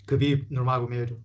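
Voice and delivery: كبيب نور ما قوم يدو in a mock English accent; hammed-up English mangling of the name. Teacher mocking English speakers again. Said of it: "الإنجليزي شيء بعيد جدًا".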